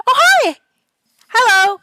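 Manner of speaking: high, loud friendly greeting; cheery hello. Acted. Friendly neighbor waving to the party, loud cheery greeting. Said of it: "keep waving to you and going".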